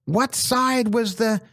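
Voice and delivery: a director suddenly frantic about continuity; mock shrill panic from the director; panicked director. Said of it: "freaks out at that point and goes".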